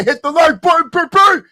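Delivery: high excited yell at the chat; hype shout. Real, not acted. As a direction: pump the viewers up, voice jumping high. Hyping viewers to like video.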